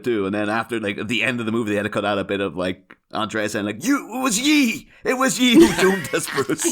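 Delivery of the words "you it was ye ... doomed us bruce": hammed-up old sea dog voice, higher and slow; old sailor. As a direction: growl it out like a pirate-era sailor. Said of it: "spoke like a, like an old sailor".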